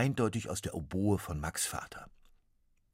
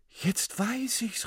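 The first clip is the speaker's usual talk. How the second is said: put-on higher, slow triumphant boy; excited call; 4 dB louder, slower than usual. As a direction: call it out as Max hits on the idea. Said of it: "rief Max".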